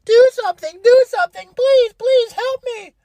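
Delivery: high-pitched, slow wailing plea; mock bawling. Girlfriend begs her boyfriend for help in court, mock high wailing. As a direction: wail it like a panicking crybaby. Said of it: "starts bawling her eyes out".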